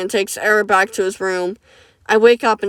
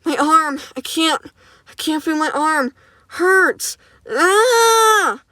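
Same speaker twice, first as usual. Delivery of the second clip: high, strained pained yelling; acted panic over a snapped arm; crying out in pain; 8 semitones higher, much slower than usual. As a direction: howl through a broken arm. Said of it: "I scream in pain".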